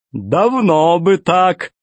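high, slow jolly villain; fat pirate voice. Acted. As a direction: play the grinning pirate boss. Tolstyak gloats.

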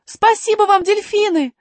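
high loud grateful call; calling out thanks. Acted. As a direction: shout thanks across water. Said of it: "крикнула Алиса". (благодарный крик)

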